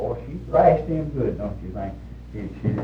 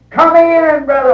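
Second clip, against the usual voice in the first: high, loud, drawn-out call; overjoyed mock invitation; hearty welcome, imitated; 13 semitones higher, 6 dB louder, slower than usual. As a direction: holler a welcome to a visitor. Acts out hearty invitation at door.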